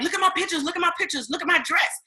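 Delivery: imitating excited friends. Friends voiced in retelling.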